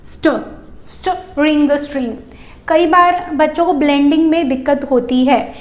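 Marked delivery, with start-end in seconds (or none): 0.0-2.1 "स्ट" said twice, then blended with "रिंग" into "स्ट्रिंग"; cluster blending. Shown as a demonstration. Teacher teaching string for kids. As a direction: sound out "स्ट", "रिंग", then join them. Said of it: "रिंग में add किया".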